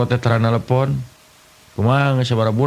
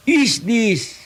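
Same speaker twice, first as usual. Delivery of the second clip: much higher, slow mock English; broken English; 10 semitones higher, much slower than usual. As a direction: ham up clumsy English. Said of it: "Bahasa Inggris tapi kepakojot". (bahasa Inggris ngawur, nada tinggi dibuat-buat)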